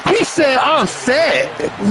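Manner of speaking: high-pitched mimicry; exaggerated high parody of a boy; mocking a boy. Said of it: "Put some bass in your voice".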